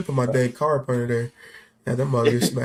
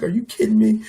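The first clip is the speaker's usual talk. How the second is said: higher-pitched disbelieving retort; retold incredulity; 7 semitones higher than usual. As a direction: throw it back in mock disbelief. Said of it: "I was like".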